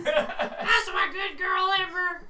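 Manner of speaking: slow, laughing praise; praising dog. Real, not acted. Owner praising the dog, slow and laughing. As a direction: cheer the dog on, slowly.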